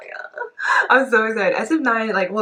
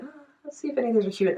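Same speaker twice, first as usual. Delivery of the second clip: higher, quick playful inner voice; mock curious; 7 semitones higher, much faster than usual. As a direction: perk up as if checking them out.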